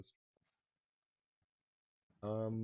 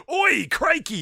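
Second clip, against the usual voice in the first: mock Australian cartoon yell, much higher and louder; bad Australian accent; 10 dB louder, much slower than usual. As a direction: ham up a put-on Australian accent. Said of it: "some whatever voice actor to go".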